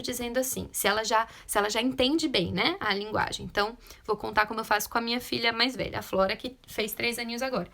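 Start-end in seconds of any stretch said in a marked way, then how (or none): none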